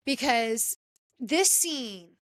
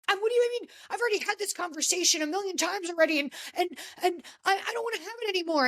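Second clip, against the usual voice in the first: higher and fast, exasperated; imitating an annoyed husband brushing friends off; hammed-up fed-up whine; mock irritated rant; 7 semitones higher, much faster than usual. Jax pissed at intervention visitors.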